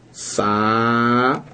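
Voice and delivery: SA- held long on its own; sílaba isolada. Shown as a demonstration. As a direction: stretch out the syllable Sa- by itself. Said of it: "Saída, né, ó". (Sa- alongado, separado do resto)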